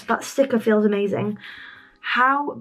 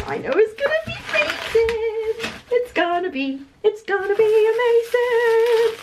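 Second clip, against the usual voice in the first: high-pitched sing-song glee; excited squeal; 12 semitones higher than usual. Real, not acted. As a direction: squeal it out in giddy anticipation. Excited before opening a notebook.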